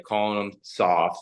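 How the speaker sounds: ordinary delivery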